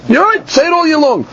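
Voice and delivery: excited concession; eager agreement. Retold or imitated. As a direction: concede it with a lift of enthusiasm. Acting out Rebbe Eliezer agreeing.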